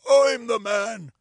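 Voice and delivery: loud, drawn-out squeak; squeaked reply. Acted. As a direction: squeak it out slowly and loudly. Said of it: "squeaked the other".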